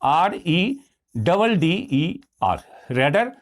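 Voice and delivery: slow, letter by letter; slow letter-by-letter spelling; spelled out. Shown as a demonstration. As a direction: spell each letter out slowly. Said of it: "तो ये बनेगा".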